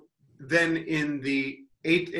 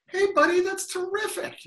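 high, sarcastic cheer; sarcastic cheerful praise; mock praise. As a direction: heckler mock-congratulating the player. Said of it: "I also was like".

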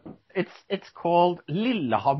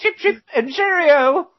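very high, louder fake English cheerio through laughter; laughing mock British; 5 dB louder than usual. Host cracking himself up with a mock British goodbye, laughing. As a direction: giggle out a silly English farewell. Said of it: "that's between us Englishmen".

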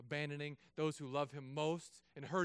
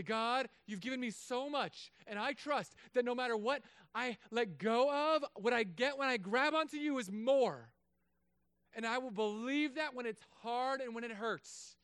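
slow, higher, earnest prayer; model prayer; 7 semitones higher, 5 dB louder than usual. Shown as a demonstration. Pastor showing the church how to pray, slow and earnest. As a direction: pray it slowly and sincerely. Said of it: "Go to God and say".

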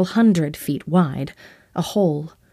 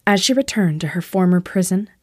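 ordinary delivery, nothing marked

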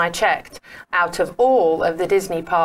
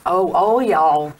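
slow, loud attempt at American Southern; practising an American Southern phrase slowly; 5 dB louder, much slower than usual. Shown as a demonstration. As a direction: try on a Southern US "all y'all". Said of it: "you gotta get all y'all".

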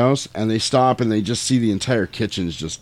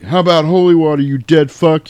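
higher, louder defiant quote; mock heroic comeback; 5 semitones higher, 6 dB louder than usual. Brothers threaten the vampire with holy water, defiant. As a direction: throw it back as a hammed-up challenge. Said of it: "And they're like".

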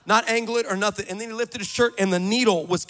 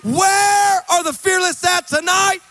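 loud, high, shouted sermon build; fiery preaching; 6 semitones higher, 9 dB louder than usual. Acted. Preacher rallying the congregation, fiery shouting. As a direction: preach it over the crowd at full force.

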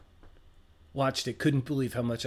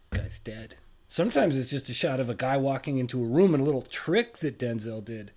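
usual speaking voice

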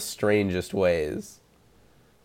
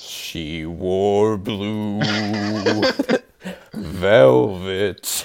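sick voice; much slower than usual. Real, not acted. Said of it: "fits your sick voice".

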